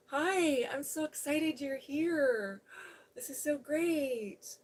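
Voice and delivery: high, loud, slow, gushing hello; excited welcome. Real, not acted. Host gushing over a viewer joining, high and excited. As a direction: greet them as if overjoyed, drawing it out.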